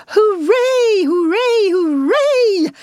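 high, loud repeated cheering; jubilant cries. A narrator's reading. Rat dancing over his discovery, jubilant cries. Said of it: "the rat cried".

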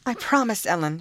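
high, eager promise; girl eagerly promising her nurse; eager cry. A narrator's reading. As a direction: blurt it out eagerly. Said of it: "she cried".

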